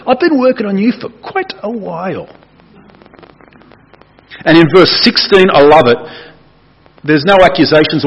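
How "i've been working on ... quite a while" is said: a knowing, lightly teasing divine aside; lightly voiced Jesus. Jesus to Saul about goading him, lightly voiced.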